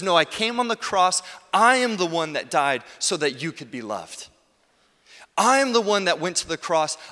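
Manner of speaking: emphatic preacher voicing a declaration; Jesus. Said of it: "Jesus says".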